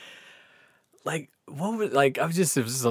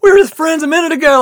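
loud, high, rushed protest; mock protest; 11 dB louder, faster than usual. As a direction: plead your case over a hostile crowd. Protesting to those turning on him.

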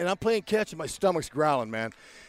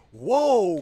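loud stunned whoa; amazed whoa; 3 semitones higher, 7 dB louder than usual. Retold or imitated. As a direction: gasp it out in awe. First sees his future wife.

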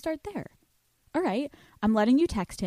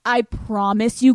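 ordinary talk, nothing marked

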